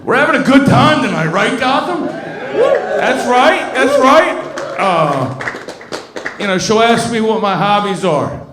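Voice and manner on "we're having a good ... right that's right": high, loud call-out building up; rally the audience, getting louder; hyping the crowd. Real, not acted.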